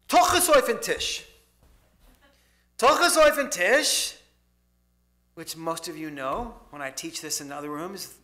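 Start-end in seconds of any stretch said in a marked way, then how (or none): 0.1-4.2 put-on loud, higher, with a Polish accent; emphatic Yiddish saying. Great aunt telling her nephew to sit, loud Polish accent. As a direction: declare it like a bossy old aunt. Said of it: "with her Polish accent".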